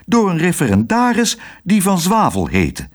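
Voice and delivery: high-pitched excuse; flustered nurse's explanation; crying out. A narrator's reading. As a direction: keep defending yourself in a high voice. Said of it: "riep zuster Snijbiet".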